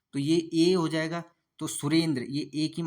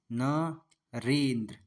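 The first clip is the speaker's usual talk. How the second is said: slow drawn-out word; 2 semitones lower, much slower than usual. Shown as a demonstration. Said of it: "तो ये हो जाएगा दोस्तों".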